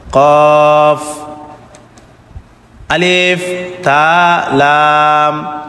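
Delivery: কফ, আলিফ, তা and লাম sustained on a level tone; name each letter slowly, holding its vowel; separate, stretched letter names; drawn-out letter names. Shown as a demonstration. Teacher names letters for learners.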